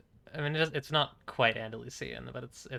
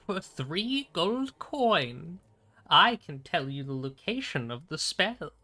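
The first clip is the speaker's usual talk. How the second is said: slow, swooping, hammed-up fantasy voice; drawn-out mock-grand quest giver, pitched up; hammy fantasy character; 5 semitones higher, slower than usual.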